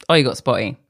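usual speaking voice

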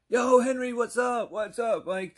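high, loud mock holler that eases off; hyped greeting. Storyteller mimics the old greeting to Henry and Mike, hyped. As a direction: holler it like an overexcited regular.

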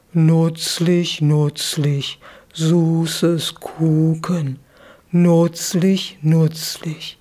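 slow, mumbling dreamy zebra saying "nutzlich" without ü; sleep-talking zebra. Acted. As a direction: murmur like a sleeping animal.

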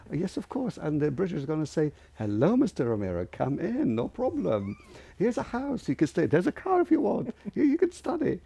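very high, sing-out fake welcome; mock sarcastic rant. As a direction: scoff by playing an absurdly generous host. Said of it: "He was cursing and swearing".

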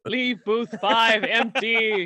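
slow, grandiose stage villain; Phantom of the Opera. Acted. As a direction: declaim it with mock operatic menace. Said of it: "Is he the Phantom of the Opera".

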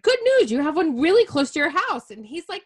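high, loud, bubbly friend with good news; excited retold friend. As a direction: pitch it up like an eager friend sharing news. Mark called me and he's like.

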